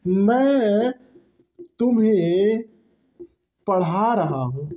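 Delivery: example sentence drawn out with long pauses; slow example. Shown as a demonstration. Teacher modelling a present-tense example, slow and deliberate. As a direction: give the example word by word, slowly. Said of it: "जैसे".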